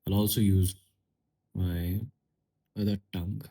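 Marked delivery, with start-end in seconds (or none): none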